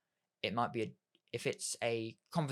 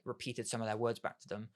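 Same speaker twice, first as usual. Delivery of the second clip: normal talking voice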